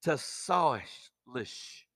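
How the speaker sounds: "tasalish" sounded out slowly, syllable by syllable; slow, careful try at "tasalish"; careful pronunciation. Shown as a demonstration. Preacher to congregation, carefully sounding out "tasalish". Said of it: "see if I can pronounce this".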